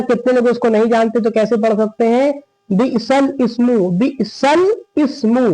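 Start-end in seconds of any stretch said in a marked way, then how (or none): 2.7-5.5 "बिसल इस्मु" slowly, laam closing bi'sal before ismu; wrong joining. Shown as a demonstration. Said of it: "ये गलत है".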